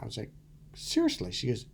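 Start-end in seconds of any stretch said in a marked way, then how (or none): 0.7-1.3 much higher, disbelieving; can't believe what he's hearing; incredulous. Retold or imitated. Told women like his wrinkles, in much higher disbelief. Surprised at goddaughter's claim.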